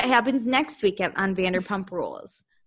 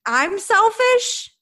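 high, loud and slow, stunned; incredulous disbelief; 11 semitones higher, 5 dB louder, much slower than usual. Retold or imitated. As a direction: drag out I'm selfish in outraged disbelief. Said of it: "I literally was just gonna say".

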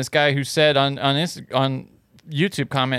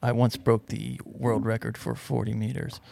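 soft, deadpan macho boast; manly man; 3 semitones lower, 8 dB quieter, slower than usual. Pretend. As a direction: quietly brag as the tough guy. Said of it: "the guy that you're doing right now".

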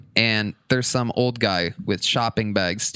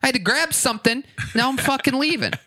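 higher, fast, fed-up retort, mock heated; retold angry rant; 11 semitones higher than usual. Telling off an angry old man who complained about his parking, mock heated and fast. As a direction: snap back at him, half laughing and hammed up.

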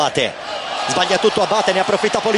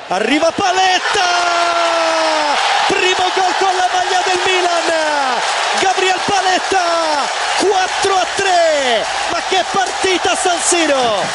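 goal shout; 2 semitones higher than usual. Real, not acted. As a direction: call the winning goal in full cry. Goal call in live commentary.

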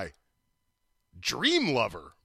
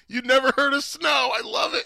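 loud, high excited disbelief; excited shout; 12 semitones higher, 7 dB louder than usual. Real, not acted. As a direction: shout it out in delight. Excited outburst about Snow.

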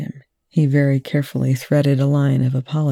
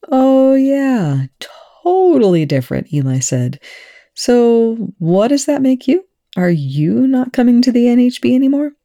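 mock surprise; 11 semitones higher, 5 dB louder than usual. A narrator's reading. Eli teasing Young.

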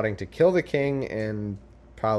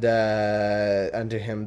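ordinary talk

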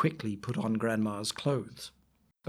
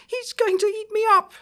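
high, loud frightened grandma cry; terrified cry; 6 dB louder than usual. Acted. Grandma seeing the wolf's teeth, high frightened cry. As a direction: shriek it like a terrified old granny. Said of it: "she cried".

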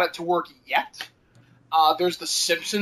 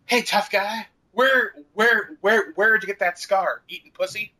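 imitating cops; 2 semitones higher than usual. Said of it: "that's the original version".

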